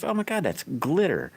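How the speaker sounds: a hint of an exasperated wife; mock the wife's dismay lightly; lightly voiced wife; imitating wife. Wife voiced in retold story.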